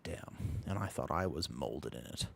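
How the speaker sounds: low, quiet imitation of a movie villain; hushed low character voice; Bane. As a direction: drop low and soft for the villain's reaction. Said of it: "Bane would look at that and be like".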